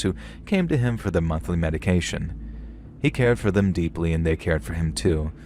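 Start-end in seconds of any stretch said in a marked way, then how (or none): none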